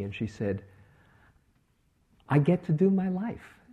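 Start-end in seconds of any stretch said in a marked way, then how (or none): 2.3-3.6 higher and brighter with a lift on do; beaming, a joyful discovery; happy and delighted. Retold or imitated. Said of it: "she came in so happy".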